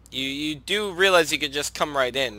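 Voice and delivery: ordinary talk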